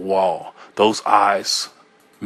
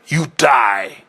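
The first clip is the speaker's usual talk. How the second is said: mild frenzied scream; slower than usual. A narrator's reading. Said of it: "I screamed at it".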